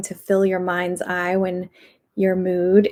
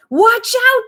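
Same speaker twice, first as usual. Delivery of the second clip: much higher, louder warning shriek; 6 dB louder than usual. A narrator's reading. Panicked dentist warning the lady, shrieking. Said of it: "the dentist shrieks".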